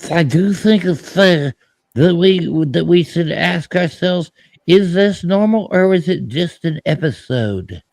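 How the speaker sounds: drawn-out mock politician statement; imitating Nancy Pelosi. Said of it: "She says".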